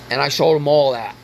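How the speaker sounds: ordinary talk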